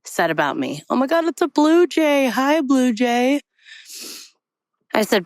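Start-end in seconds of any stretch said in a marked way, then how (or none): 0.9-3.4 high, delighted greeting to a bird; delighted aside. Real, not acted. Walker breaking off the story to greet a blue jay, delighted. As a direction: pipe up high, sweetly greeting a little bird.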